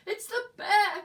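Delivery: high, loud panicked cry; frightened cry. Acted. Eddie crying out to Mum in fear. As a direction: cry it out terrified. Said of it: "cried Eddie".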